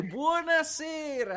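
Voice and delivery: slow, high hammed-up greeting; imitating enthusiasm. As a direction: stretch out a mock cheerful hello. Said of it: "quanto entusiasmo".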